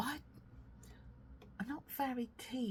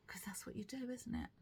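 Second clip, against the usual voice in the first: 1 whisper; 3 semitones higher, 7 dB quieter, faster than usual. Real, not acted. Whispered aside while showing knitting.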